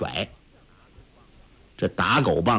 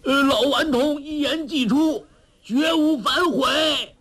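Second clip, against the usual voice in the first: calling from afar. A narrator's reading. Zhou Botong shouts back his promise from afar, calling out. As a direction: stretch it out high as if from far away. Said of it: "周伯通远远地说".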